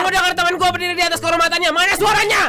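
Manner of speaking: high, shouting hype; rally the crowd like an MC; hype man. Acted. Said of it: "hype man rallying".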